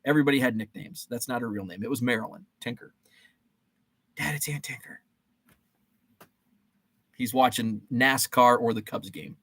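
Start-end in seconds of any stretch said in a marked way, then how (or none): 4.2-5.0 whispered, half-hearted call to dad; soft, toneless retold call; hushed call. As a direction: imitate a kid quietly passing on a message. Retelling calling dad to phone.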